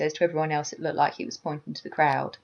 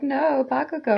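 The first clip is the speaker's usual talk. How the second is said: high, pleading mock call; Izuku; 8 semitones higher than usual. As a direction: call after someone in a higher imitation voice. Voices Izuku chasing after Bakugo.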